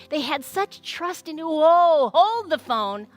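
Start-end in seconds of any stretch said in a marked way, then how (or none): 1.4-3.2 loud sudden whoa then a gasp; hammed-up surprise; mock surprise. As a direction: stop everything as if struck by an idea. Sudden excited interruption.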